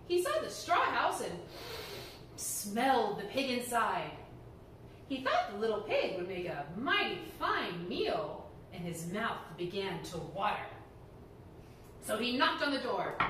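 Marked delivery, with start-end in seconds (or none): none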